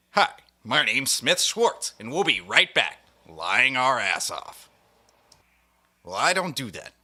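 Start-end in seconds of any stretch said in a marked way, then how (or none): 0.1-4.7 slow, smarmy anchor voice, louder; mock news anchor. Mocking a CNN anchor with a slow, smarmy anchor voice. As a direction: ham up a cable news host signing off. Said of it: "like the man with two last names".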